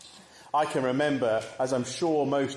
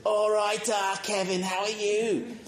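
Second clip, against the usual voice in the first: put-on Perry's northern accent; 8 semitones higher than usual. Teenager back from Manchester greeting his mate, mock northern accent. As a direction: lay on a northern accent, pitched up high. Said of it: "he's speaking in a northern accent".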